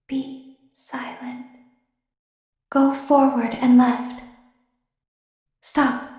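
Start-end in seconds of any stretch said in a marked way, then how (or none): 0.1-1.5 slow, barely audible hush; urgent whisper. Acted. Warning the technician as Ballora passes, in a slow whisper. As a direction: breathe the words out so Ballora won't hear. Hiding from Ballora, must stay hidden.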